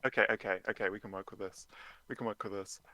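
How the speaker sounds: low muttered to self; quiet. Acted. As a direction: mutter it under your breath. Detective muttering, much quieter.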